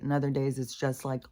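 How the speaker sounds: ordinary talk, nothing marked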